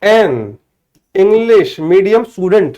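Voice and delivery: spelled out slowly, piece by piece; slow, careful correction for a student; slow dictation. Shown as a demonstration. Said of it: "तो यदि आपने आई लगाया है तो".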